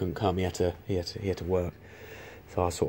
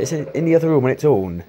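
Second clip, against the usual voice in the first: put-on high, loud Welsh accent, a bit hammy; 6 semitones higher, 5 dB louder than usual. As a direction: keep up the rough Welsh accent. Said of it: "I can't do a very good Welsh accent".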